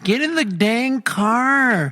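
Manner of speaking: high, loud, drawn-out mom yell; mock-exasperated mom holler, stretched out; soccer mom. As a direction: call the kids to the car like a fed-up soccer mom. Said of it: "like a soccer mom right now".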